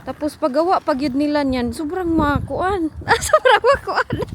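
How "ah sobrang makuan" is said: high, louder, drawn out through laughter; laughing. Real, not acted. Parent talking about the best gift, laughing. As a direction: repeat it while breaking into a laugh. Laughs while repeating own phrase.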